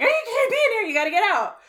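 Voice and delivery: high, loud fretful voice shooing people out; mock flustered wife. Imitating the young wife shooing the guests away. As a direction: flap like a nervous host. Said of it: "Jennifer Lawrence is like".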